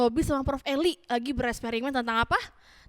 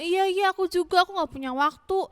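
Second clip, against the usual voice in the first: higher, lightly acted chiming in; a hint of an eager excuse-maker; agreeing villager; 6 semitones higher than usual. One of the people answering Hagai, lightly acted agreement. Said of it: "Ada juga yang bilang".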